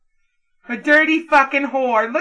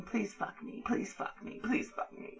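mock whispered begging; 17 dB quieter than usual. Mocking the partner's begging.

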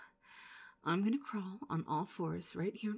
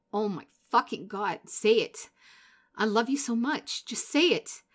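urgent pleading; 8 dB louder than usual. Acted. Begging partner to say love.

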